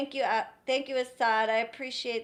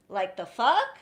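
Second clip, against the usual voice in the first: ordinary talk, nothing marked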